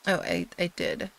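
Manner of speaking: ordinary delivery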